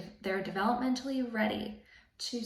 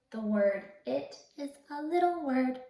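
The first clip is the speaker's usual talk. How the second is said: a touch higher, small voice; little voice; 4 semitones higher than usual. Shown as a demonstration. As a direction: sound tiny and cute. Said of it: "say it in a really little voice".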